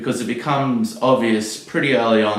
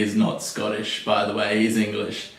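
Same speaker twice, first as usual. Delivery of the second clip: normal talking voice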